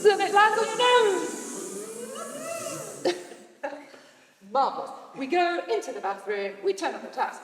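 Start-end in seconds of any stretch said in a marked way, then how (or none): 0.0-1.4 high, loud playful alarm; high, loud startled play; excited. Pretend. Teacher acts bee landing. 1.4-4.0 high laugh. Real, not acted. Bee scene laugh.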